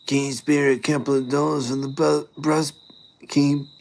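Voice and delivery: high, wheedling eshay voice; Ashay accent. Pretend. Eshay asking for bus money, high mock eshay accent. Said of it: "speak in a bit of an Ashay accent".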